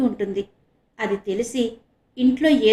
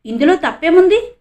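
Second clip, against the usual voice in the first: surprised question, much higher and louder; 8 semitones higher, 9 dB louder than usual. A narrator's reading. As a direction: ask in disbelief, pitch leaping up. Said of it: "ఆశ్చర్యంగా అన్నాడు ఆనంద్".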